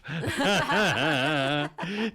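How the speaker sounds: high rising laugh mid-impression; laughter. Real, not acted. Laughing at his own robot skit, high rising laugh. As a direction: break up laughing at your own joke.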